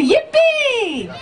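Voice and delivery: high, gleeful yippee; yippee shout. Shown as a demonstration. Teaching the crowd, a high gleeful yippee. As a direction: whoop it out with glee. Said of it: "So you say".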